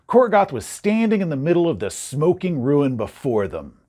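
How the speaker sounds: ordinary talk, nothing marked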